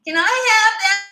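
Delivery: high, loud, drawn-out whine; mimicked child's whine. Shown as a demonstration. Parent mimicking her five-year-old's whining tone of voice, whiny. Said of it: "And I would mimic it".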